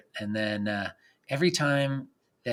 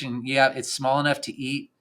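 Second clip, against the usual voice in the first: ordinary talk, nothing marked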